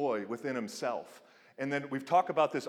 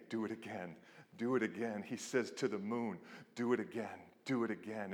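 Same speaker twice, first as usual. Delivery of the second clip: soft; 4 semitones lower, 7 dB quieter than usual. Acted. God to sun and moon.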